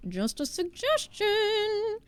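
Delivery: high, slow coy hint; teasing sing-song. Acted. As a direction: drop the hint coyly, drawn out.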